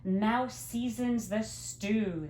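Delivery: plain reading voice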